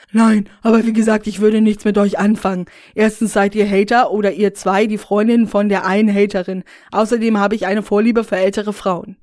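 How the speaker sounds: loud, self-satisfied character voice; smug YouTuber Rainer. Acted. Rainer turning the women down, loud and smug. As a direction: brush them off like a smug YouTuber.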